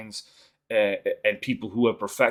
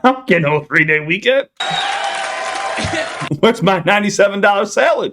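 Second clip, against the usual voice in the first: laughing; 11 semitones higher, 7 dB louder than usual. Real, not acted. Laughing at the comedy clip.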